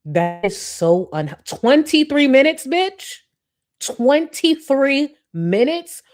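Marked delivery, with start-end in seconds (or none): 1.5-3.2 loud, high-pitched disbelief; incredulous outburst. Real, not acted. Mocking outrage at the long wait. 3.8-6.0 very slow, high, rising disbelief; drawn-out incredulity. Real, not acted. Repeats the outrage, drawn out.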